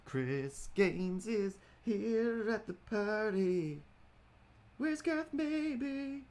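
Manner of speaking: very high, slow silly voice; high put-on voice. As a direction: squeak it out way up high. High mock party announcer voice.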